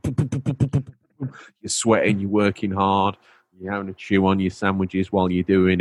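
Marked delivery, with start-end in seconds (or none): 0.0-1.3 high chanted boom boom of a rivet gun; rivet gun noise. Retold or imitated. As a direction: hammer out the rivet sound rhythmically.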